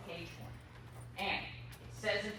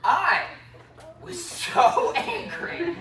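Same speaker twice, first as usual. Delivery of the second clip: laughing through the word; 11 semitones higher, 14 dB louder, much slower than usual. Real, not acted. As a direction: chuckle while saying it. Laughing while telling.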